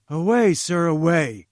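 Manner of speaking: loud, sharp angry dismissal; angry cry. A narrator's reading. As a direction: drive him out of the room with the words. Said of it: "he cried angrily".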